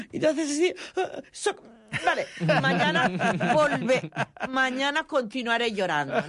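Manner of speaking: mother playing her son sobbing, mock sobs; mock sobbing gasps. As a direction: gasp through fake sobs like a crybaby. Child mock-sobbing his promise.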